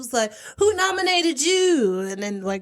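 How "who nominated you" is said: high, slow host question; host questioning each mother on stage, high voice; talk show host. Retold or imitated. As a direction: ask it like a peppy TV host. Host interviewing dancing mothers.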